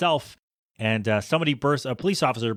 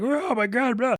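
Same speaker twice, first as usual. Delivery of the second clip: mock panic; 11 semitones higher than usual. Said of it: "they're like".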